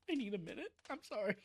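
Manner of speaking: soft, teary voice, fighting the tears; quietly pulling yourself together through tears; real tearful apology. Real, not acted. Streamer apologizing to chat while crying, soft and teary. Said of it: "I don't mean to be so emotional".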